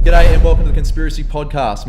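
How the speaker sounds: loud show intro. Acted. Podcast host booming his opening welcome to listeners. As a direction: announce it like a host kicking off the show.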